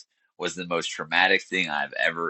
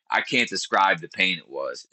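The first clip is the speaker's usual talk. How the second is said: normal talking voice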